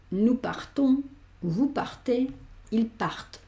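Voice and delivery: partent said part with a clear T; T sounded in plural. Shown as a demonstration. Teacher models plural for students: partent with a sounded T. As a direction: land the T in partons, partez, partent. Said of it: "pronuncio esta T".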